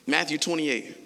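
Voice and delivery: ordinary delivery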